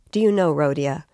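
plain reading voice

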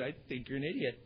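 low, soft deadpan aside; quietly candid punchline; blunt aside. Retold or imitated. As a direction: drop the voice for a blunt confession. Retold blunt aside after a laugh.

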